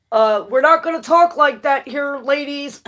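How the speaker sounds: high, loud mock scolding of the ladies; mind scolding. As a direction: mind telling the body off, mock stern. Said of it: "your mind's in here like".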